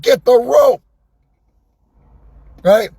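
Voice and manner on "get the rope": retold call. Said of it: "I said".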